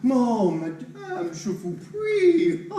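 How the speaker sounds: slow, high, hammed-up French gallantry; overdone French charm, mocking her manners. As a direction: simper it like a mock French courtier. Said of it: "In French, perhaps?".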